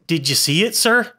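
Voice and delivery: ordinary delivery, nothing marked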